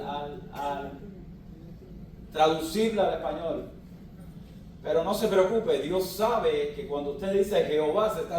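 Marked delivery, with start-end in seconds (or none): none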